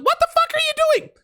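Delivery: mock yell across a lot. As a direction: scream it at him across the parking lot. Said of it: "She yells across the parking".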